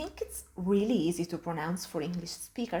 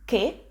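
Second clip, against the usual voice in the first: Che as "keh" with rising pitch; upward question intonation; 3 semitones higher, 6 dB louder than usual. Shown as a demonstration. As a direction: ask Che as a one-word Italian question. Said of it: "with a slightly upward intonation".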